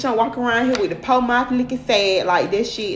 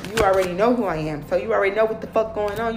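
ordinary delivery

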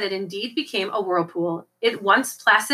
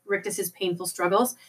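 plain reading voice

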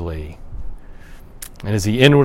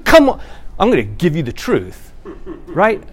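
mock zealot; 4 semitones higher than usual.

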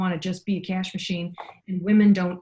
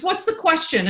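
higher, urgent and tearful; urgent editor; 11 semitones higher, 4 dB louder than usual. Retold or imitated. Editor crying in the shower asks urgently, higher. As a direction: demand it through tears. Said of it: "And she goes".

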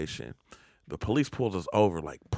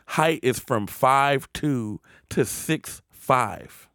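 normal talking voice

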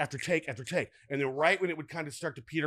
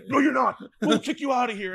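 guards shouting; 9 semitones higher, 6 dB louder than usual. Retold or imitated. Guards stopping him on field.

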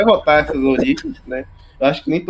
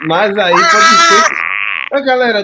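loud, slow outcry; excited shout; 5 semitones higher, 6 dB louder, slower than usual. Real, not acted. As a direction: cry out in dismay. Outburst over Skywalker sequel.

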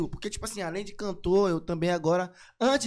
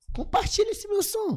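cheeky high plea; 13 semitones higher than usual. Pretend. Cheeky plug after fan praise.

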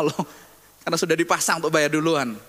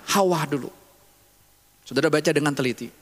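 normal talking voice

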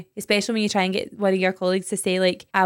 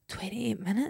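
stunned; 11 dB quieter than usual. Retold or imitated. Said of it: "then go back and go".

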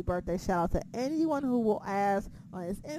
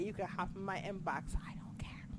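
sultry murmur; 4 semitones lower, 14 dB quieter than usual. Pretend. Flirty line repeated softly.

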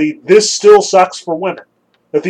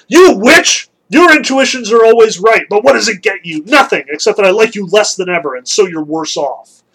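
exasperated Zeus; 5 dB louder than usual. Acted. Zeus caught out by Hera, mock-indignant outburst. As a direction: burst out at her, then settle into grumbling. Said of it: "And Zeus is like".

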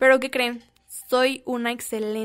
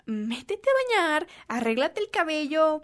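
ordinary talk, nothing marked

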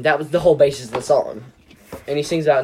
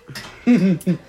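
embarrassed laugh; 5 semitones higher, 6 dB louder than usual. Real, not acted. Embarrassed after readjusting.

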